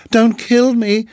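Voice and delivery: high, tearful pleading; almost weeping. A narrator's reading. Frightened muskrat begging the mongoose, almost weeping. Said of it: "almost weeping".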